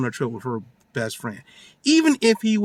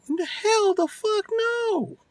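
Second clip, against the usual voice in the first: high, slow, indignant mock outburst; mock outrage in a high put-on voice; outraged boyfriend; slower than usual. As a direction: squeal it like he can't believe it. Boyfriend refusing, acted outburst.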